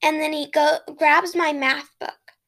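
usual speaking voice

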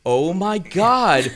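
slow, loud, high mock shock; retold astonishment. Buyer hearing the table's weight, drawn-out astonishment. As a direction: draw it out in stunned disbelief.

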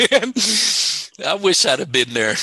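laughing loudly at the punchline. Real, not acted. Amused teller laughing at the woman's reply to the preacher. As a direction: burst out laughing, then carry on.

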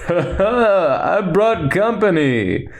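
chuckle, then announce it in a playful put-on voice; Lando. Lando bursting in late to the battle, jolly mock announcement. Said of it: "Lando definitely just comes in like".

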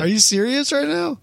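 can't believe what he hears; explosive rage. Real, not acted. Friend plans to melt batteries.

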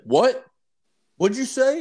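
high, loud mock tough-guy challenge; retold thug demanding a repeat; mobster challenge. Mobster confronting him outside restaurant.

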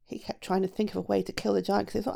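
ordinary delivery

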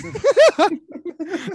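high burst of real laughter; loud laugh. Real, not acted. Laughing at Fireball mix-up.

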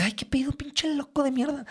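high, soft frightened curse; scared cursing. Retold or imitated. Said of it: "Yo me cisqué y dije".

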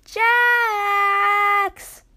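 long, high, loud yell; drawn-out high shout. A narrator's reading. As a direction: call out the name at full volume, drawn out. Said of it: "she yelled again".

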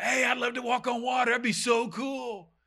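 high, goofy eager voice; mock enthusiasm. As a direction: gush like a thrill-seeker. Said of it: "It wasn't like".

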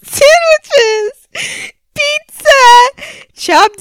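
high, loud words through laughter; breaking up mid-read; laugh-crying. Real, not acted. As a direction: squeal the list while cracking up. Laughing while reading list.

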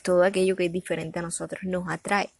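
ordinary talk

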